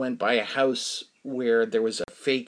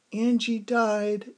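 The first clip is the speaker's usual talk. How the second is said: grieving neighbor; 10 semitones higher, much slower than usual. Retold or imitated. Neighbor Ellen telling a passerby her dog died, slow and grieving. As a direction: let the news drop slowly, hurting.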